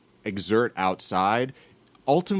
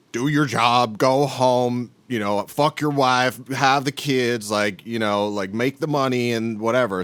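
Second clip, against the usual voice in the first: mocking slight Southern accent, louder; 4 semitones higher, 4 dB louder than usual. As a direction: sneer out the rules in a light Southern twang. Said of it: "a slightly Southern accent".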